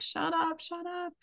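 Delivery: high-pitched, hushed mock scream; retold scream. Mother snapping at her raging daughter, mock scream held down. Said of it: "I screamed at her".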